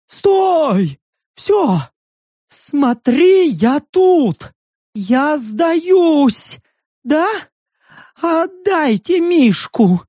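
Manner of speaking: high-pitched pleading cries; desperate surrender. A narrator's reading. Stranger gives himself up for the bear, desperate pleading.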